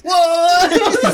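very loud, high, drawn-out comic shout; mock outrage. Worker hears that word at the counter, mock outraged shout. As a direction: shriek it in disbelief, half laughing. Shocked reaction, retold.

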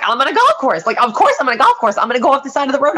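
high, loud, frantic rush of words; panicked. Retold or imitated. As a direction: relive the panic of being lost at night. Retelling panic, lost on golf course.

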